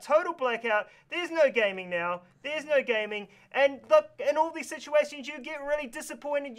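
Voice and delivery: ordinary delivery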